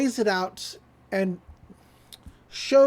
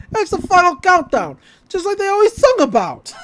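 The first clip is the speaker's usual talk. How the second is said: excited joke; 10 semitones higher, 9 dB louder than usual. Retold or imitated. Zeus joking excitedly.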